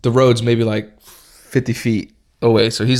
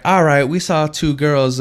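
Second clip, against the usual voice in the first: slow, higher mock officer; cop voice; 4 semitones higher, 4 dB louder than usual. Campus cop explaining who they saw, slow higher voice. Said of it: "she's like".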